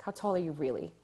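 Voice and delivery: put-on lightly done New York accent. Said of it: "in her, like, New York accent".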